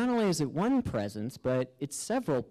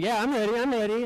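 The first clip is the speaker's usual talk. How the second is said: higher and louder, bursting to go; eager reply; 9 semitones higher, 4 dB louder, faster than usual. Retold or imitated. Narrator answering the spirits, higher and louder with eagerness. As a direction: answer like a kid who can't wait. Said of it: "I'm saying".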